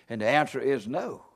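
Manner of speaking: hushed answer. Real, not acted. Preacher answering his own question, low and quiet. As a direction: drop right down for the answer.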